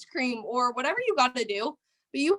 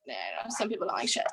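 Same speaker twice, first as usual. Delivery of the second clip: whispered aside; 11 semitones lower, 6 dB quieter than usual. Real, not acted. Host whispers a cheeky aside to her team, hushed. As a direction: drop to a low unvoiced whisper. Cursing aside on training call.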